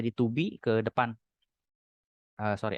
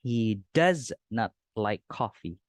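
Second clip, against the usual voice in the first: He does not like coffee, each word said apart, slowly; slow full form; slower than usual. Shown as a demonstration. Teacher shows the full form slowly to the class. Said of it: "Atau secara berbicara, bisa disambung".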